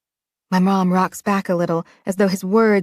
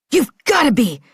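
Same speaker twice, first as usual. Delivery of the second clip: yelled outburst; 5 semitones higher than usual. A narrator's reading. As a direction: let it rip at the top of your lungs. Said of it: "he yells at the top of his lungs".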